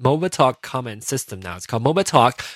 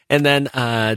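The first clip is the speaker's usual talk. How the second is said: normal talking voice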